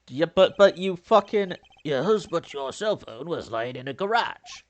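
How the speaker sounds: ordinary talk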